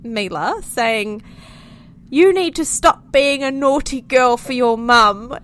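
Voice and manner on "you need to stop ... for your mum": called out louder and higher, imitating a scolding stranger; mock the random man telling a child off from his car; stranger calling out. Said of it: "calls out of his car to us".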